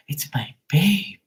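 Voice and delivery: mocking someone thrilled at a call; mock swooning whisper. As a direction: whisper like a lovestruck fan. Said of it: "be like".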